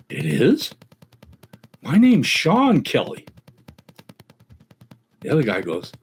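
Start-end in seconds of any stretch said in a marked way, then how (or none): 0.1-3.2 high-pitched, hammed-up astonishment; acted, delighted disbelief in a higher voice; amazed joke character. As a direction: play up amazement at a coincidence.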